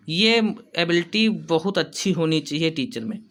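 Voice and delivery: normal talking voice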